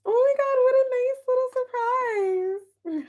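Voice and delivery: very high, tiny squeaky coo; touched, delighted. Real, not acted. Streamer surprised by a friend's arrival, tearfully delighted. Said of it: "I feel like I'm about to cry".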